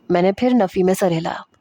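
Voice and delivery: ordinary delivery, nothing marked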